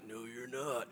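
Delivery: low, very quiet deadpan; horse's cold retort. Retold or imitated. Horse answers the sneaking liar, low quiet retort. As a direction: drop low and murmur it as a menacing horse. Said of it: "he was just like".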